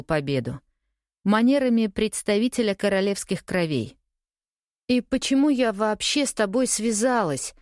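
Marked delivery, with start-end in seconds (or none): 4.9-7.5 higher-pitched, impulsive outburst; blurted out. A narrator's reading. Woman lashing out at her former lover, blurting it out. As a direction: blurt it at him with a touch of cheek. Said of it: "выпалила я".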